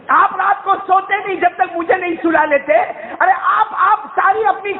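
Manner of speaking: put-on high, tearful little boy's plea; a child begging his father through tears; pleading child. Little Ismail pleading with his father not to harm him, high and tearful.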